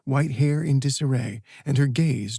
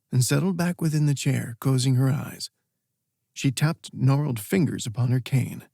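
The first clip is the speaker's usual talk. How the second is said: ordinary delivery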